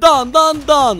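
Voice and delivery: loud, high mock gunfire; imitated gunshots. Gamer voicing his bullets as he shoots, imitated gunshots. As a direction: fire the shots with your voice.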